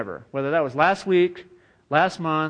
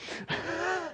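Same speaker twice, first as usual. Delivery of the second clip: high breathy laugh; 12 semitones higher, 12 dB quieter than usual. Real, not acted. Laugh after joke.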